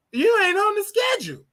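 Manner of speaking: high, mock-shocked boss voice; hammed-up high announcement; imitated boss. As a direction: pitch it way up as the boss breaking the news. Acts out boss's news.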